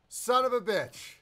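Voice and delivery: loud, sarcastic cursing; fed-up, irritated outburst; real annoyance. Real, not acted. Host curses hacker freezing show.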